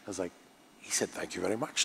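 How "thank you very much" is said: Sean Connery. Retold or imitated. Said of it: "the way he says it".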